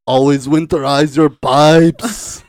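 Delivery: loud, slow, hammed-up Freeze line; mock villain boom.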